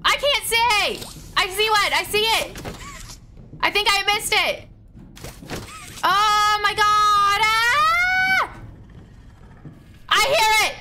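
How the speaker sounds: terrified gamer yelling at the monsters; panicked screaming. Real, not acted. Hunted by monsters in game.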